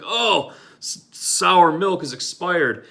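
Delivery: high, slow disgusted outcry at sour milk; disgusted cry. Retold or imitated. Friend tasting sour milk, high and disgusted. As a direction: spit out the words in disgust.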